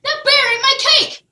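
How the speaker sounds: loud, high, upset shout; outraged cry. Acted. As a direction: shout the loss. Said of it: "cried Jack".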